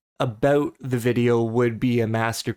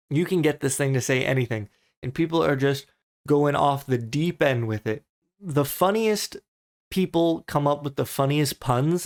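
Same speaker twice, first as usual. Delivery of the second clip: ordinary delivery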